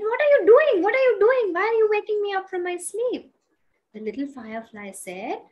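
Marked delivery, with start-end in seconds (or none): 0.0-3.3 high, loud squawking protest; angry outburst. Acted. Eagle scolding firefly for waking it up, angry outburst. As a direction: demand loudly, high-pitched and outraged.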